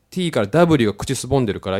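ordinary talk, nothing marked

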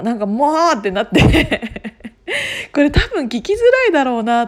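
amused, laughing at the mishap; laughing while talking. Real, not acted. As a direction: tell it through real laughter. Amused retelling of failed recordings.

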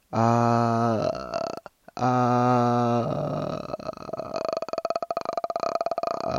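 creaky vocal fry ah; edge voice. Shown as a demonstration. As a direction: tighten the throat, creak it. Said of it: "みたいなね".

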